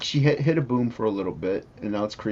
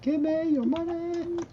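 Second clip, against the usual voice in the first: very high, slow squeak; squeaky high voice; 3 dB quieter than usual. Pretend. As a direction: squeak out the demand.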